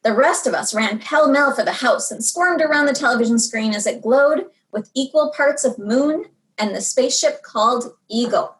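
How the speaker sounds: plain reading voice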